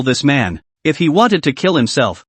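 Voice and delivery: plain reading voice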